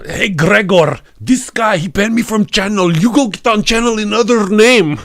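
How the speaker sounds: loud, high, thick mock Russian accent; mock Russian troll. Banned troll ordering Gregor onto the channel, hammy Russian accent. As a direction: bellow indignantly in broken-English Russian accent. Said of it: "It was like the one guy was like".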